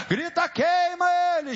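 normal talking voice

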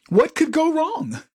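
high, slow sarcastic lilt; mock innocence, pitched high. To the audience about the candidate, sarcastic.

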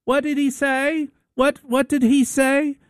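very high, slow, suspicious wife voice. Retold or imitated. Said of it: "She goes".

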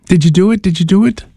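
normal talking voice

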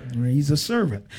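ordinary talk

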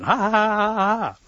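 loud, high gobbled cackle; high-pitched turkey ha-ha; turkey gobbling laugh. A narrator's reading. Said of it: "he's gobbling".

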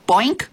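high, rising springy boink; door boink. A narrator's reading. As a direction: pop out a short boink. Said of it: "the door made a noise".

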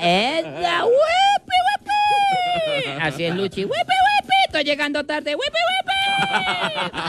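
falsete muy agudo de mujer costeña; voz aguda de mujer. Acted. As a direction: chilla como una mujer costeña exagerada. (falsete chillón de costeña)

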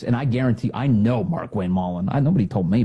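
ordinary delivery

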